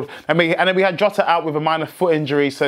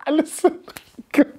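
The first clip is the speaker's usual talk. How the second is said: emotional laugh; 12 semitones higher than usual. Real, not acted. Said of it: "I'm getting emotional like him".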